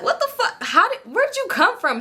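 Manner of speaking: very high-pitched disbelief; retold startled reaction. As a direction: act out being startled, voice shooting up. Startled by a voice beside her.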